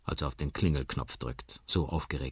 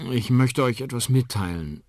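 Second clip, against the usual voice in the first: ordinary delivery, nothing marked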